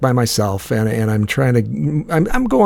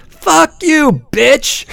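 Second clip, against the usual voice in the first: a sudden screamed curse, pitched way up; furious shout; 5 dB louder, much slower than usual. Retold or imitated. Cursing at the honking girl in the car, shouted high. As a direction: yell it way up high and loud.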